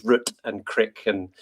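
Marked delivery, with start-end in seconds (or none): 0.0-0.3 root with a short vowel, rhyming with "foot"; American root. Retold or imitated. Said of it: "And she still says". 0.6-1.0 crick for "creek", short "i"; American crick. Retold or imitated. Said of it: "And she still says".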